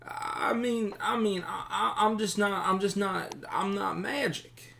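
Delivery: high, stuttering, helpless voice; nervous captive bandit. Acted. Captive bandit admits he lacks magic sight, high and pleading. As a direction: squeak out a stammered excuse.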